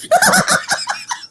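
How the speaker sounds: helpless high laughing fit; high burst of laughter. Real, not acted. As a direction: crack up at your own failed attempt. Laughs at own attempt.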